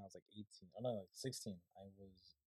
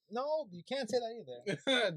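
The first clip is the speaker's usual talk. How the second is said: higher, slow drawn-out no; retold emphatic protest, pitched up and slowed; firm refusal; 9 semitones higher, 4 dB louder, slower than usual. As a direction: put your foot down, stretching it out.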